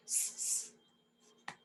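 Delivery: two separate voiceless "s" hisses, very soft; isolated S sound. Shown as a demonstration. Teacher demonstrating the S sound, soft hissed "s". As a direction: hiss "s" twice, no vowel. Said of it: "it makes the S sound".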